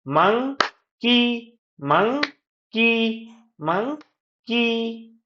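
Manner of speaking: clap-count rhythm, pause between मं and की; syllables to claps. Shown as a demonstration. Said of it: "clap से भी कर सकते हैं".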